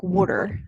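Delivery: "water" as "wooder"; Philly "water". Shown as a demonstration. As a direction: put a "wood" vowel in "water". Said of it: "a W O R".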